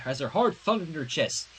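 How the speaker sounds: usual speaking voice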